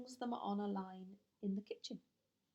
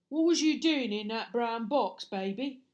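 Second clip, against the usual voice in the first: loud rough cockney-ish burglar; Burglar Bill voice; 8 dB louder than usual. Acted. Burglar questioning the crying baby, gruff and loud.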